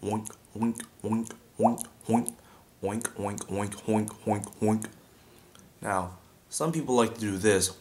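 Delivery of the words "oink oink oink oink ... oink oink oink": oink with a breathy h start, popping like a drip; water drip oink. Shown as a demonstration. Showing students the drip sound, hollow popping oinks. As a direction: pop each oink like a drop of water.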